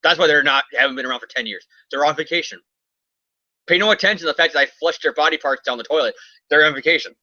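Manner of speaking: usual speaking voice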